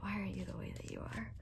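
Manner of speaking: soft whisper to self. Retold or imitated. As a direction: whisper it into the mirror. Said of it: "I look in the mirror and I'm like".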